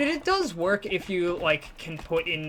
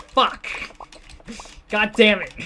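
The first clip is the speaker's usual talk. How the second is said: loud frustrated curse, a quick then b; retold outburst; 4 semitones higher, 6 dB louder than usual. Recalling his comment slip, a loud frustrated curse. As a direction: swear loudly at your own mistake. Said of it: "And then I was like".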